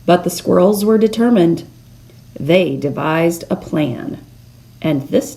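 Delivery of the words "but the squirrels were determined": ordinary delivery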